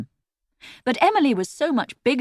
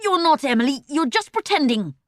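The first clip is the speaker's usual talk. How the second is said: loud scolding; 7 semitones higher, 4 dB louder than usual. A narrator's reading. Erg scolding teddy.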